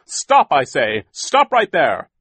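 loud, high command, drawn out at first; calling out. A narrator's reading. Mister Poe orders fleeing villains to halt, calling out. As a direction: call a halt to someone far off. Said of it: "called out again".

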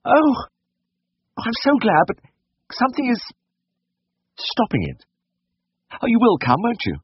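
very high, hesitant falsetto; fretful wealthy lady, relieved but anxious. A narrator's reading. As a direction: plead with the vet as a worried lady. Voices the worried lady.

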